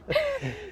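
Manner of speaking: a sudden high burst of laughter. Real, not acted. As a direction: laugh out at the teasing. Laughs at joke about Andy.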